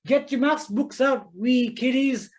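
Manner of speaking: high, loud, drawn-out mock Glaswegian teacher call; attempted Glasgow accent. Primary teacher rallying her class, attempted Glasgow accent. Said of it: "going in a Glasgow accent".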